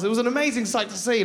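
ordinary talk, nothing marked

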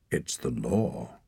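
low, slow, final; cold verdict. A narrator's reading. Clan leader refusing the boy, low and slow. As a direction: state it without pity. Finkedin pronounces sentence.

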